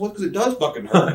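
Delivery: real laugh while answering. Real, not acted. Laughs at absurd request.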